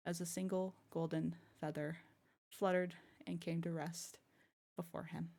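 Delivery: soft storytelling voice; story narration. Acted. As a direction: read it gently like a tale. Story narration ending lore.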